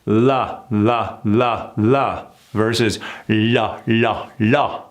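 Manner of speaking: slow, exaggerated la with the tongue forward; teacher modelling forward la sounds slowly; slow forward la drill. Shown as a demonstration. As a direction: stretch each la out, tongue at the teeth. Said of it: "relax that tongue, bring it forward".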